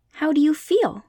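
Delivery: put-on eager little girl checking on her friend; curious Dorothy.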